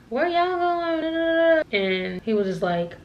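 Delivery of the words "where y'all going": high, drawn-out mocking whine; mock annoyed girl. Annoyed girl in line asks where they're going, mocked high and slow. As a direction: imitate a miffed girl, stretching it high. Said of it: "she was getting mad".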